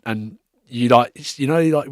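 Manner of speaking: usual speaking voice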